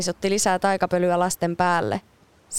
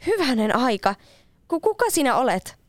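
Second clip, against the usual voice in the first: put-on startled girl; 6 semitones higher than usual. Girl woken by a stranger.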